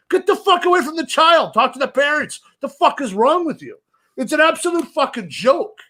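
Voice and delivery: heated shouting, pitched high; angry rant. Real, not acted. Scolding the employee on air, angry. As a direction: tell them off furiously. Ranting about Disney employee.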